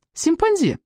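high, mocking lisped echo; snapping back. A narrator's reading. Brother answers the little one's question, snapping back. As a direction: throw the lisped word back, high-pitched. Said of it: "огрызнулся Жан Г".

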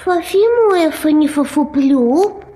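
slurred through a swollen tongue; lisping with a fat tongue. Acted.

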